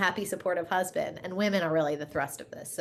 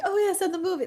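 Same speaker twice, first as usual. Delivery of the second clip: mock breezy; 13 semitones higher, 4 dB louder than usual. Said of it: "I'm like".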